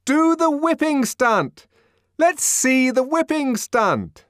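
high, slow, loud mob demand; clamouring crowd. A narrator's reading. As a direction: shout it out like rowdy spectators. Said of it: "answered a hundred voices confusedly".

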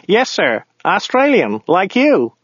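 high voice for the driver; driver character. A narrator's reading. Driver assuring his passenger, high character voice.

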